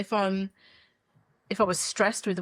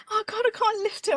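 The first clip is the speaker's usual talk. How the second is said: retold upset; 12 semitones higher than usual. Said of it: "I was really upset".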